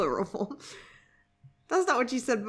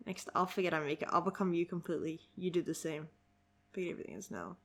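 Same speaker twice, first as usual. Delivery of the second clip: understated dialogue reading, trailing softer; 2 semitones lower, 8 dB quieter, faster than usual. Acted. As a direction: read it quietly and matter-of-factly.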